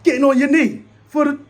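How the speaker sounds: loud, high, disbelieving rant; exasperated yell. Retold or imitated. Fan yelling at his coach's call, exasperated. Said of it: "I'm like".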